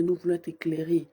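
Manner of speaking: ordinary talk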